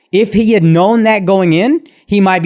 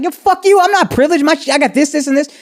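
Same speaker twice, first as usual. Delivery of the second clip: mock angry outburst; 9 semitones higher than usual.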